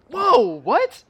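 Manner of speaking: high, loud startled whoa; shocked. Retold or imitated. Reacting to the surprise line, loud and high. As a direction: jolt up as if caught off guard. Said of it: "It's like".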